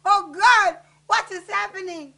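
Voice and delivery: loud, distraught cry to God. Acted. Woman calling on God as horror unfolds, crying out. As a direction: cry out in bewildered anguish. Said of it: "I cried out".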